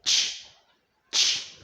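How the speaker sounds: short "ch" burst of breath; isolated voiceless CH. Shown as a demonstration. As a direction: say "ch" alone without voice. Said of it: "And the last one here".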